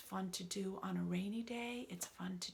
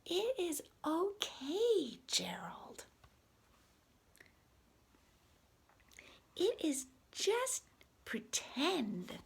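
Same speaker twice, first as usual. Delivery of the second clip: reassuring Piggy; 9 semitones higher, 4 dB louder, much slower than usual. Acted. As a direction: soothe a scared friend.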